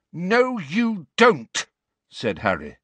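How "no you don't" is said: a sudden, slow, high outburst of defiance; loud and strong. A narrator's reading. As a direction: hit each word hard and loud. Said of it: "his voice was suddenly loud and strong".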